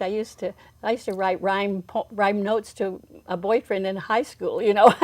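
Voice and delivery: normal talking voice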